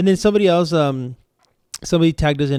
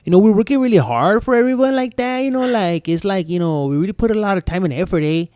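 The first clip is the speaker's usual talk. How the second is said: put-on a light, unsure Chicano accent; 4 semitones higher than usual. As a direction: try out a Chicano accent, loosely. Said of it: "I don't have my Chicano accent down".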